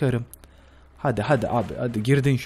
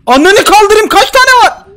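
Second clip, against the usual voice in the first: loud, much higher furious scream; enraged shout; 15 dB louder, slower than usual. Real, not acted. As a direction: scream at the screen. Yine mayın patlıyor. (öfkeyle çığlık atarak)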